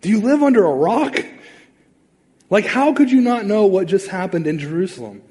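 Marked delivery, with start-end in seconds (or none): 0.0-4.1 incredulous. Retold or imitated. Disciples mocking Jesus' ignorance.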